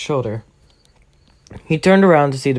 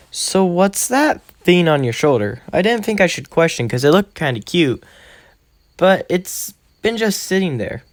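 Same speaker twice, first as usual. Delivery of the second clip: lightly voiced young girl, higher and curious; a hint of a bubbly classmate asking; higher voiced girl asking Deku a question; 6 semitones higher than usual. Uraraka asks about Bandit.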